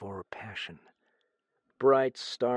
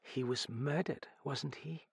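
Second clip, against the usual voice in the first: soft, halting, rising question; quiet question; 5 semitones higher, 7 dB quieter than usual. A narrator's reading. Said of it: "She asked quietly".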